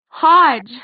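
high, loud, infuriated shout. A narrator's reading. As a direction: shout the name in fury at him. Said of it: "she shouted".

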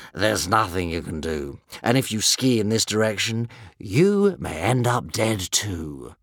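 slow, lowish dragon drawl; leisurely, fatalistic old beast voice; world-weary One Eye. A narrator's reading. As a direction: pronounce doom slowly, unbothered. One Eye speaks in story.

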